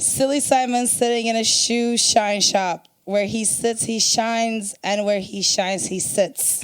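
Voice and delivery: fast reading. Shown as a demonstration. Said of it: "read as fast as you can".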